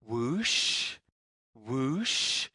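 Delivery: ordinary delivery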